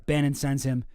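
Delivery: ordinary delivery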